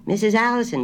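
high, loud country grocer straining to hear; a country shopkeeper on a bad line; rustic grocer. A narrator's reading. Grocer asking her to repeat, high and loud.

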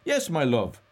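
high, loud, playful reply; teasing endearment. A narrator's reading. Mulligan teasingly answers Stephen.

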